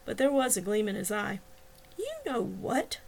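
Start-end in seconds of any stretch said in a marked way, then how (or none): 2.0-3.0 high, slow, pointed hushed reply; exasperated hush. A narrator's reading. Woman answering his feigned innocence, exasperated hush.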